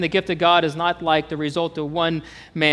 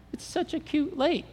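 high, soft cutesy voice; mock cooing; 10 semitones higher, 8 dB quieter than usual. Preacher teasing his tiny childhood lake, mock cooing. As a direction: coo over it, playfully belittling.

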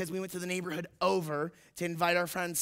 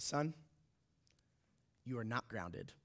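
low, soft, measured imitation of mom; pause, then deliver it gently and low; quiet verdict; 7 semitones lower, 10 dB quieter than usual. Mom sparing her son punishment, imitated quiet calm.

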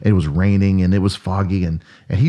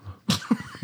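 put-on child's shocked gasp; 12 dB quieter than usual. Shocked child hearing dad curse.

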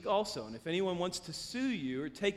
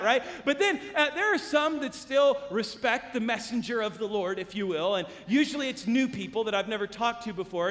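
ordinary talk, nothing marked

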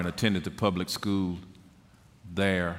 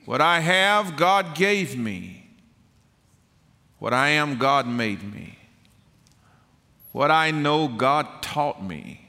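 full-force preaching; 12 semitones higher, 6 dB louder than usual. Real, not acted. Preacher testifying of faith to the congregation, fervent and loud. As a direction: proclaim it to the congregation, stretching the key words.

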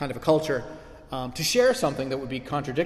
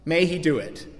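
rising, loud closing blessing over music; closing benediction; 2 semitones higher, 5 dB louder than usual. Acted. Preacher blessing the congregation at the close, rising and fervent. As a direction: lift it into a final blessing.

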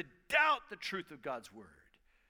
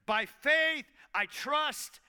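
preached emphasis; 6 semitones higher, 7 dB louder, much slower than usual. Real, not acted.

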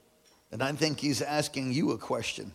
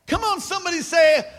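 ordinary talk

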